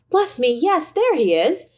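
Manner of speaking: high, delighted exclamation; delighted recognition. A narrator's reading. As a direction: greet the memory with sudden joy. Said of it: "said Scrooge to the ghost".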